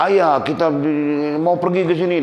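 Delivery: put-on high, whining child begging to go out; whiny child. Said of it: "dia nangis-nangis dia". (anak merengek minta dibawa keluar dengan suara tinggi)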